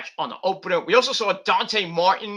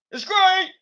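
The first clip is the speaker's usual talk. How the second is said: excited squeak; 13 semitones higher, 5 dB louder than usual. Acted. Excited squeal about name.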